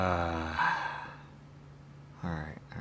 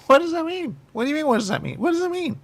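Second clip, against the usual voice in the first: insistent; 12 semitones higher, 5 dB louder, faster than usual. Real, not acted. Insistent questioning exchange.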